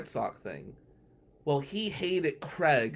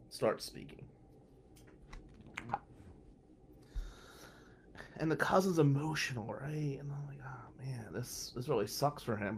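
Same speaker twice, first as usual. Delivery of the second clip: whispering at a funeral; hushed whisper; 2 semitones lower, 8 dB quieter than usual. Real, not acted. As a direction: keep it to a whisper, unvoiced.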